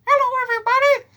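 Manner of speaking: squeaky, very high cartoon mouse; mock Mickey Mouse greeting in a tiny falsetto; high-pitched mouse voice. Shown as a demonstration. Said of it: "do him high-pitched 'cause he's a mouse".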